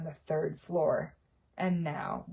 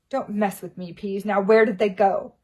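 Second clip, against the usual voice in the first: angry caretaker; 2 semitones higher, 10 dB louder than usual. A narrator's reading. Filch threatening Peeves.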